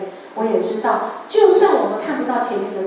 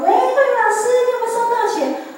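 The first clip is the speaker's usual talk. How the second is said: put-on much higher, bright young caller's voice; assistant on the phone; 14 semitones higher, 3 dB louder than usual. Young assistant calling the teacher, high eager voice.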